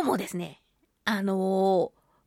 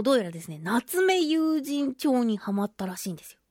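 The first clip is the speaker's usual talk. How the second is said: ordinary talk, nothing marked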